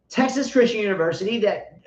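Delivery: normal talking voice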